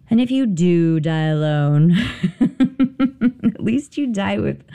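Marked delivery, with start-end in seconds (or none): 1.9-4.6 laughing through the words, slow and higher. Real, not acted. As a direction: giggle while saying it. Laughs at her own joke.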